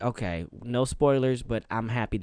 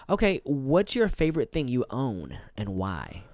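ordinary delivery

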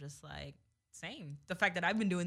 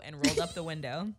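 usual speaking voice

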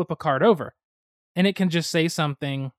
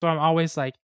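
ordinary talk

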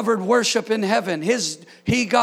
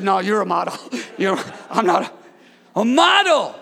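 laughing denial; 5 semitones lower than usual. Retold or imitated. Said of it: "one dude looked at another dude and said".

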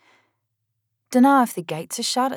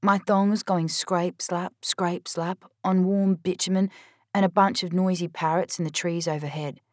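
ordinary delivery

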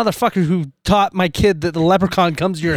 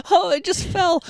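mock-innocent excuse, pitched much higher; a hammed-up wife feigning innocence; 12 semitones higher than usual. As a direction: play it falsely innocent, voice up high. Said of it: "Calling Jacob".

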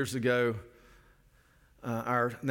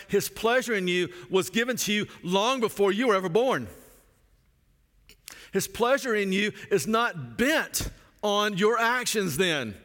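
raised, emphatic pulpit preaching; fervent preaching; 6 semitones higher, 3 dB louder than usual. Acted. Pastor preaching to his listeners, fervent and raised. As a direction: preach it with conviction to the congregation.

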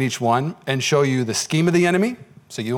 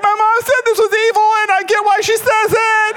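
put-on whiny little kid. Beaten friend quitting the game, much higher childish whine. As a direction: whine like a kid quoting his mommy. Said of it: "he's like".

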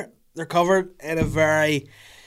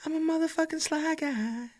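silly high voice; 4 dB quieter than usual. Acted. Silly self-boast.

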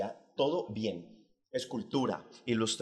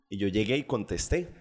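usual speaking voice

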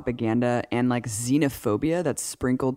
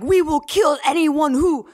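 high, loud villain voice; hammed-up Soviet minister barking orders; cartoon Russian villain; 14 semitones higher, 5 dB louder, slower than usual. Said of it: "talking like that".

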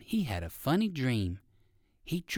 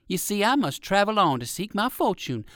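put-on higher, polite old gentleman rabbit; 6 semitones higher than usual. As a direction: decline kindly as an old rabbit. Uncle Wiggily voiced higher.

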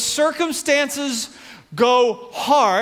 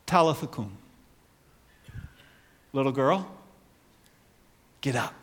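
tender command; 8 semitones lower, 6 dB quieter, faster than usual. Retold or imitated. Jesus raising the girl tenderly.

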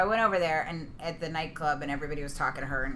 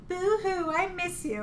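mock whimper; 12 semitones higher, slower than usual. Mock sad text message.

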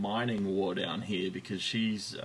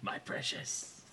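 creepy whisper; 14 dB quieter, slower than usual. Retold or imitated. Gollum impression after stroking story.

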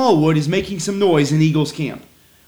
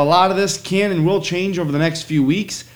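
ordinary delivery

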